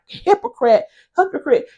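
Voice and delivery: Hypocrite, hypocrite tossed back high and scoffing; mocking echo. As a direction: parrot the word back mockingly. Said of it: "he turned himself back around like".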